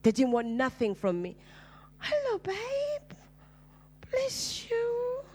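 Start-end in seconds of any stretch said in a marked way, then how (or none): 2.0-5.2 very high, slow, tender voice with a tearful catch; sweet churchgoer. Acted. Church member greeting the lonely girl, very high and tender. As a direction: coo it like a doting church mother.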